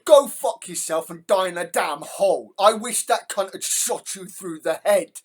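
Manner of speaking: loud furious rant; yelling back at the president on screen, furious; angry shout. Real, not acted. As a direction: throw every word at him in real rage. Reactor shouting back at the clip.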